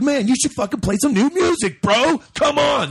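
high-pitched retold indignation; mock indignant. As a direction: plead in exaggerated disbelief at a buddy. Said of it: "Like, I'm all indignant".

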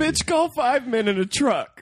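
high, slow sassy voice; sassy mock voice. Friend delivering the punchline, high slow sassy voice.

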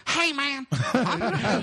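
high-pitched mock party-guy voice; Matt. Friend announcing he is going out, high hammed-up impression. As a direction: squeal it like an over-eager buddy. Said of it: "and Matt's like".

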